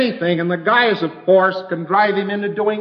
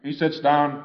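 ordinary talk, nothing marked

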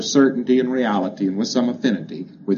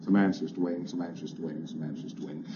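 rote repetition, fading away; 5 semitones lower, 12 dB quieter, faster than usual. Shown as a demonstration. Auditor drilling the pre-clear, sinking to a near-whisper. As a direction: say it again and again, much softer each time. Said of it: "give me some answers to waiting".

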